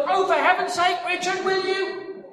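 father shouting at his son in frustration; exasperated shout. Retold or imitated. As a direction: blurt it out in pained exasperation.

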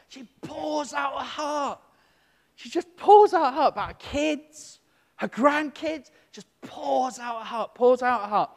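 high, loud, repeated insistence; emphatic preaching. Acted. Preacher stressing how the woman poured her heart out, loud. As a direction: hammer the phrase home.

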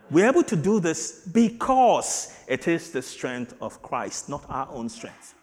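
loud, rising sermon delivery; full-force preaching. Acted. Pastor preaching loudly to the congregation about strength. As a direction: preach it out to the church.

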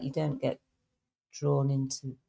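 ordinary delivery

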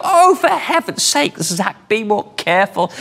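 put-on a fed-up wife at a messy child; exasperated wife. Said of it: "my wife would go".

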